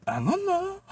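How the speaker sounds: very high squeaky voice; mimicking clueless boss. Boss with no answer for the waitress, squeaky. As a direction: squeak it helplessly.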